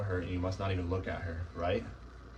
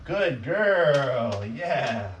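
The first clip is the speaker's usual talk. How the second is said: higher, much louder praise for the puppy; happy praise; 5 semitones higher, 7 dB louder, much slower than usual. Real, not acted. Trainer praising the puppy in her crate, high loud praise. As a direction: reward her with your voice.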